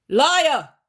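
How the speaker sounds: high, loud accusing shout. Acted. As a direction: hurl the accusation. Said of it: "raising his voice louder all the time".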